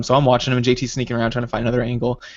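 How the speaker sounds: ordinary delivery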